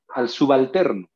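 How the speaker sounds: ordinary delivery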